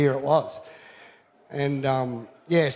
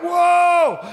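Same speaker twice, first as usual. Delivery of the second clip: high loud startled whoa; excited shout; 14 semitones higher, 8 dB louder than usual. Retold or imitated. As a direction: shout it in alarm. Retelling the near collision.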